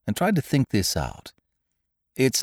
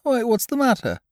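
much higher, bright bear voice; cheerful Pooh. A narrator's reading. Pooh Bear greets his gloomy friend, high and cheerful. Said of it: "said Pooh".

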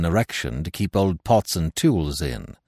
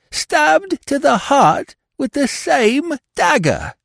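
much higher, louder, panicked servant; Françoise, frightened maid; 5 dB louder than usual. Acted. Maid Françoise tells Hastings of another death, terrified and high. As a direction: wail about the curse on the house, rising in terror.

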